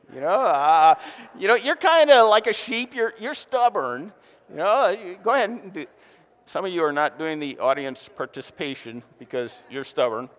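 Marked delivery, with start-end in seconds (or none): 0.1-5.9 mock taunting, loud and higher; teasing the neighbor. Shown as a demonstration. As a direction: poke fun at the person beside you. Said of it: "Turn to the person next to you and say".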